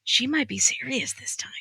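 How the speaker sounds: hushed eerie whisper. Retold or imitated. Podcaster voicing viewers' eerie worry, whispered. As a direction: breathe it like a secret.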